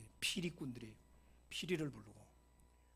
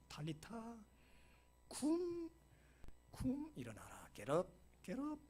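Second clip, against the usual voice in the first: put-on softer, slow, tender call to wake a child; kind, gentle voice; 6 semitones higher, 5 dB quieter, slower than usual. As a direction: rouse her as gently as a loving parent. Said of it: "정말 인자한 목소리를 이렇게 하는 거예요".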